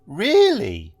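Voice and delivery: high, loud, gushing Really; exaggerated interest. A narrator's reading. Receptionist to the private detective, exaggerated interest. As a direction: overplay the fascination. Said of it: "with exaggerated interest".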